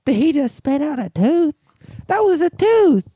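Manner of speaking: mom's voice. Retold or imitated. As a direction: shriek it like a stunned mother. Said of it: "And his mom's like".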